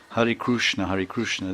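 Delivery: Hare Krishna with a Kru sound, Krushna; Oriya-style Krushna; Oriya pronunciation. Retold or imitated. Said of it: "Like in Orissa, they say".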